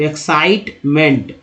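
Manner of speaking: एक्साइटमेंट slowly as एक्साइट-मेंट; syllable by syllable. Shown as a demonstration. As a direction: lengthen साइट, then add मेंट in एक्साइटमेंट. Slow syllable demo of the word.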